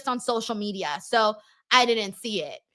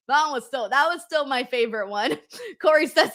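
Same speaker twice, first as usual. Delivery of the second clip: ordinary delivery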